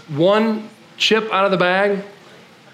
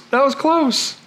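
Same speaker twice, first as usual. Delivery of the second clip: ordinary talk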